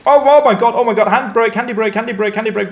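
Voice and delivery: high, loud panicked yelping; real alarm as the lorry rolls off; oh no, stop it now. Real, not acted. Handbrake slipping, truck rolling away.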